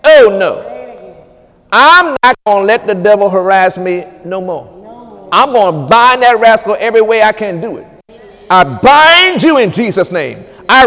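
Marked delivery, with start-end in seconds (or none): none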